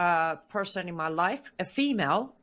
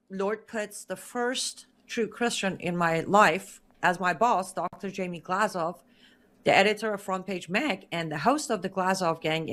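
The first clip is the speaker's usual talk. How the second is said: usual speaking voice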